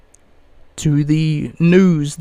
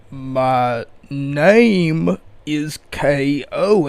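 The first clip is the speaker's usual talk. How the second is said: slow mocking drawl; much slower than usual. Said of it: "his initial, you know".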